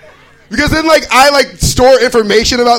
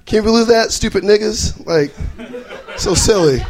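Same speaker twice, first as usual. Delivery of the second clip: usual speaking voice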